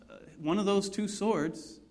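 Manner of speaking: usual speaking voice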